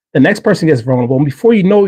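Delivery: ordinary talk, nothing marked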